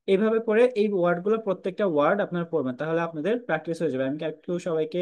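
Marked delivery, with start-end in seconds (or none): none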